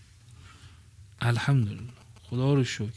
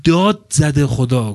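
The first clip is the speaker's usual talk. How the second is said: slow and louder, punching داد; loud slow stress on داد; emphatic shout; 3 semitones higher, 7 dB louder, slower than usual. Shown as a demonstration. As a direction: let داد زده خدا ring out slowly like a cry. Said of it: "تأذن یعنی داد زد".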